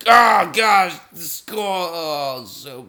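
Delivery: loud, high cry of dismay; dismayed outburst. Real, not acted. Gamer yelping at his bad score.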